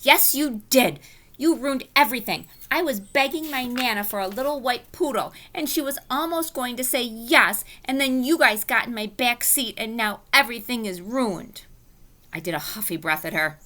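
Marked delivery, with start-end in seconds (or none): 0.0-11.6 stamp your foot and complain; upset whining. Acted. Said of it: "Lucille stamped her foot".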